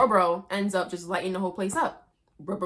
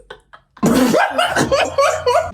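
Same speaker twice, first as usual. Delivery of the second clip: high burst of laughter; loud laugh; 13 dB louder than usual. Real, not acted. Vlogger laughing hard at the brutal scene.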